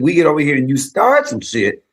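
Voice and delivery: put-on loud defiant teen warning; defiant retold warning. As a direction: tell the troublemaker you won't be bullied. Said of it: "So I said".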